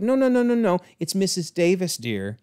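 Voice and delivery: high, prim correction of her name; a fussy neighbour setting her straight; sweetly insistent old lady. Acted. Mrs Davis corrects Agatha.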